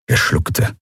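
usual speaking voice

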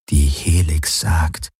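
langsam, zweifelnd nachgesprochen, Betonung auf Helix; skeptisches Echo. A narrator's reading. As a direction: seine Worte ungläubig wiederholen. (sie wiederholt seine Worte zweifelnd und langsam)

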